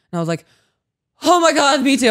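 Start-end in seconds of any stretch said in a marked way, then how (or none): 1.2-2.1 loud, high burst of relief; retold excited relief. Climber jumping at friend's offer to quit, excited relief. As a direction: gush with relief, higher and louder.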